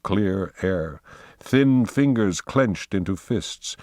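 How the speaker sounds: plain reading voice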